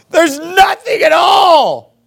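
high, slow, shouted mock wail; hammed-up outcry, stretched out and high; crying complaint. Preacher mimics Israelites with no food to eat, mock crying. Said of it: "they're crying over their food".

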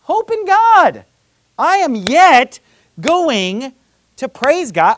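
loud, high and slow, every word lifted; rising declaration of faith. Acted. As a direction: proclaim it like a turning point, full voice. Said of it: "Wait a minute".

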